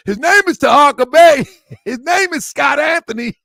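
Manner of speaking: high, loud announcer-style shout; mock call-out. Mimicking how they call him out, shouted high. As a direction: belt the name out like an introduction. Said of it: "You know how they call me out?".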